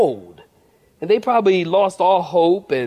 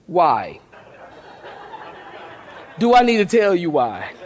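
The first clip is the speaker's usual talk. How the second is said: ordinary talk, nothing marked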